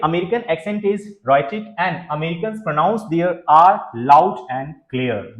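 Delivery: slow, careful read of the English line; slow reading aloud. Shown as a demonstration. Teacher reading the slide to students, slow and careful. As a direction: read it out slowly for the class. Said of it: "बिल्कुल, यानी American accent".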